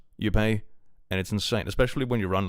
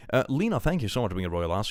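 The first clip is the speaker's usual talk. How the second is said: ordinary talk, nothing marked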